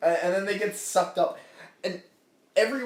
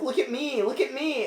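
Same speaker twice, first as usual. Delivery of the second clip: higher-pitched attention-seeking mock child; excited show-off kid; 7 semitones higher than usual. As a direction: beg the others to watch, playfully. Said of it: "he's like".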